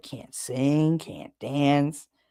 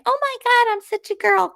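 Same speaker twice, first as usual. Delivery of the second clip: much higher mock girly squeal; frilly girly girl. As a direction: parody a frilly girl gushing. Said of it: "this frilly".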